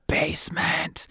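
spooky whispered option. Pretend. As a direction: whisper it like a ghost. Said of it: "Uh, B".

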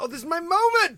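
high, loud mock-dramatic declaration; milk it like an overacting extra; hammed-up big moment. Mocking actors milking a death scene, hammed-up.

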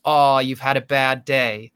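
slow, fake-nice American voice; American accent. Castmate pretends to be nice, American accent. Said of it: "you just spoke with an American accent".

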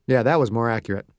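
ordinary delivery

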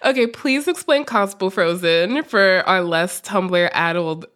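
usual speaking voice

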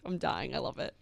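low, soft swoon; quietly smitten, said under the breath; hushed swooning aside. Retold or imitated. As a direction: murmur it like a private reaction. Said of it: "and I was like".

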